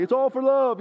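high, loud charging yell; retold battle yell. Charging the yellow jackets, a high loud yell. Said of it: "I ran in there just screaming".

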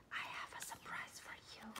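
quick breathy whisper, no voice. Shown as a demonstration. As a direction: whisper it fast like a secret. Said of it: "he whispered to Amy".

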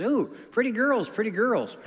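high-pitched parrot squawking compliments; high parrot voice, swooping down on each phrase; talking parrot. Acted. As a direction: coo it up high like a pet bird. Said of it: "The parrot says".

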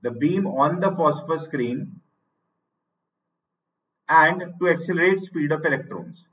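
each phrase said very slowly and spaced out for students to write down; teacher dictating notes at writing speed; slow dictation. Shown as a demonstration.

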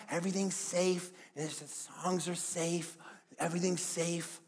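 hushed, slow mock reassurance; mock soothing. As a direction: lull them softly, with irony. Mocking a safe, riskless church.

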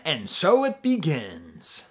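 slow, lightly hammed-up grand announcement; portentous opening line. Guy opening the song, mock-portentous slow delivery. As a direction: intone it like an epic's opening, drawn out. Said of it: "the guy is about to say".

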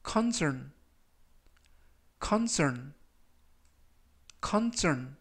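section said slowly and clearly; slow model pronunciation. Shown as a demonstration. Teacher reading vocabulary aloud for review, slow and clear. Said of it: "제가 천천히 세 번씩 읽어드리고요".

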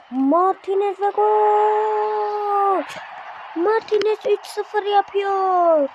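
high, drawn-out goal call; goal shout. Acted.

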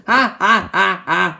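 loud hammed-up ha ha laugh. After showing a skeleton face, a loud laugh. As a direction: cackle at the face reveal.

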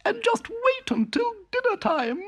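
very high and boastful little voice; proud. A narrator's reading. Town mouse boasting to her cousin, proud and very high. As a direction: brag about the feast to come. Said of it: "said Town Mouse proudly".

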